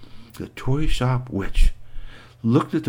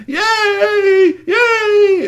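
toys cheering; 6 dB louder than usual. Acted.

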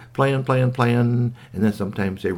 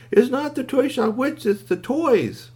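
very high, anxious toy voice; urgent squeaky toy. A narrator's reading. Upset toy explains.

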